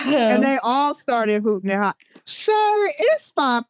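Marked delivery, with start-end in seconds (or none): 2.2-3.6 high-pitched, exasperated call to a stranger; hammed-up high protest at the noisy lifter; incredulous mock reproach; mock scolding. Mock scolding of noisy gym man.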